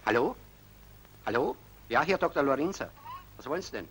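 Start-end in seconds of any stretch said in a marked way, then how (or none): none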